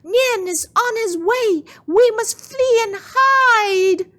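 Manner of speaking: high, loud panicked shout; Mama rousing the family, panicked yell; urgent yell. Acted. Mother waking May about Nian, frantic high shout. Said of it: "Mama yelled".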